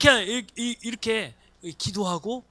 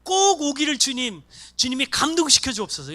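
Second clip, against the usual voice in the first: louder, higher earnest plea; fervent prayer; 5 semitones higher, 7 dB louder than usual. Shown as a demonstration. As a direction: pray it with urgent longing. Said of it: "이렇게 집중적으로 기도하는 거예요".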